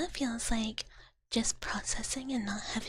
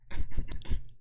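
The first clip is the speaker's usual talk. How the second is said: whispered giggle; 8 dB louder than usual. Real, not acted.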